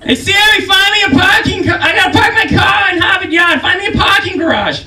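put-on very high, loud shouting in Boston accent; Boston accent to Siri. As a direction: bark orders at Siri in thick Boston accent. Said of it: "change their accent ... dictating to Siri".

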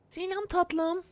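high, soft falsetto woman; man doing a woman's voice. Pretend. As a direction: purr it like a flirty woman. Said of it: "Kadın sesi yapacaktım da".

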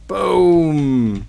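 ordinary talk

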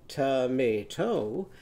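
"Tomato" slowly split into to-may-to; syllable by syllable. Shown as a demonstration. Teacher counting syllables in tomato, slow and divided. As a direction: stretch each syllable of "Tomato" separately. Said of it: "how many syllables were in tomato".

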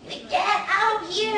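high, slow singsong scolding; mock-stern shout while playfully bopping children; hammed-up shooing call; playful grandpa threat. Shown as a demonstration. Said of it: "아이들 한 대씩 뚝뚝 때리면".

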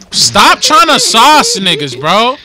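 loud, drawn-out complaint; annoyed shout. Real, not acted. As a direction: call out a teammate in real annoyance, loudly. Irritated shout at teammate in game.

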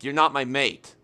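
ordinary delivery

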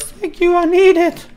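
a mock tiny voice for the arrows; silly squeaky thanks.

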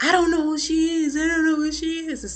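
high mock-innocent denial; mocking Zach denial. Recapper mimics Zach pleading ignorance, high. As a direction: play dumb in a high voice.

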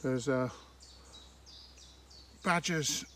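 ordinary talk, nothing marked

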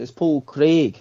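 Paul Craig in a Scottish accent, "Craig" with a long ee; Scottish accent, rolled r in Craig. Shown as a demonstration. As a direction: say the name like a Glaswegian. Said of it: "Because he's got a Scottish accent".

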